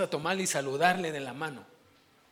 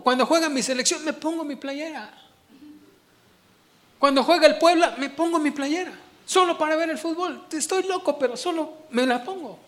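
louder and higher, laughing, fired up about his teams; excited fan; 7 semitones higher, 7 dB louder than usual. Retold or imitated. Preacher retells telling deacons about his football shirts, excited and laughing. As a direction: let it rise into a laughing shout.

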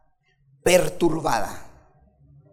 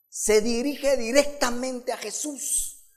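ordinary talk

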